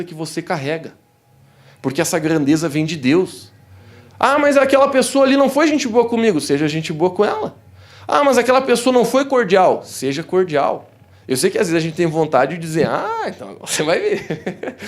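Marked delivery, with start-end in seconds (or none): 4.2-6.4 higher, louder mock complaint; imitating a believer's complaint, higher and louder; whiny objection. 8.1-9.8 higher, louder mock complaint; imitating a believer's complaint, higher and louder; whiny objection. 12.9-14.8 slow, playful threat that breaks into a laugh; preacher mocks a vengeful thought, laughing. Mock revenge thought with laugh.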